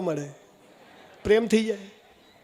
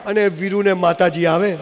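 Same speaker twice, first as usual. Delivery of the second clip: normal talking voice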